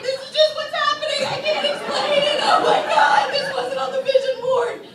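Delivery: high, laughing, helpless protest. Retold or imitated. Body excusing the tears, high and laughing. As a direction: let the laughter take the words. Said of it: "My body was like".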